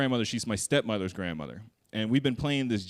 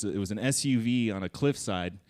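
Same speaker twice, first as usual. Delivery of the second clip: ordinary delivery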